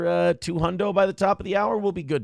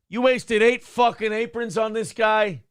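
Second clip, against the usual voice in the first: higher, slow, drawn-out mock outrage; acted movie outrage; 6 semitones higher, 3 dB louder, slower than usual. Host reading a viewer's favorite Goodfellas line, acted outrage. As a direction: quote it like an indignant movie gangster. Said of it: "Favorite line in Goodfellas".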